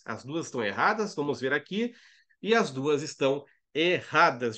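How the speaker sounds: ordinary talk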